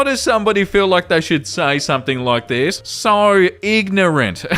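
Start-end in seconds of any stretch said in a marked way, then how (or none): 2.8-4.4 very slow, drawn-out scorn; scornful disbelief. Real, not acted. Host reacting to a comment about an actress's accent, scornful. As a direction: stretch each word out in disbelief.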